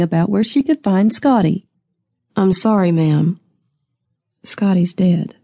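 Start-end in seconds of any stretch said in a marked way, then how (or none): none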